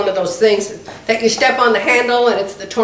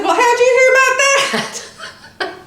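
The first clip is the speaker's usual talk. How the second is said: laughing question; 13 semitones higher than usual. Retold or imitated. Suspicious question to insurance agent.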